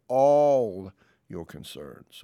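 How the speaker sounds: slow, weighty all in all your concerns; stress on all. Shown as a demonstration. Pastor emphasizing the word all to congregation, slow stress. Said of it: "I want to emphasize the word all".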